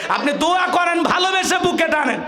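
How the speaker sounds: high, sustained preaching cry; impassioned exhortation. Real, not acted. Preacher urges the crowd to embrace newcomers, impassioned exhortation. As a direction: urge them with full-force fervour.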